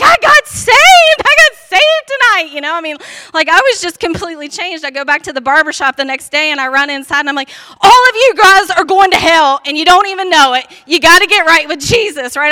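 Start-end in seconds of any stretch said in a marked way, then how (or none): 0.0-2.5 much higher, loud joyful shout; running into the house, yelling to the family that she got saved; excited shouting. Retold or imitated. 7.8-12.4 loud, pushy preaching at the barbers; running into the barbershop, loudly telling everyone they're going to hell; zealous loud warning. Retold or imitated. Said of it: "I was like a bull in a china shop".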